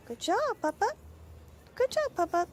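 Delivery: very high, loud praise for a dog; high-pitched pet praise. Real, not acted. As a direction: cheer the dog on in a high squeal. Praising dog after potty.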